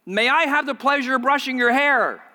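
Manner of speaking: high, loud, projected request; loud calling out. Acted. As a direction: belt out the polite question for the whole gate to hear. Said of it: "took a deep breath and said".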